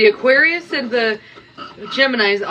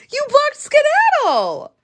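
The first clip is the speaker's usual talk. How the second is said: high, slow, incredulous; high slow disbelief; slower than usual. Real, not acted. Streamer to fan New York, slow high disbelief at the blocking. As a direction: spell out the betrayal in disbelief.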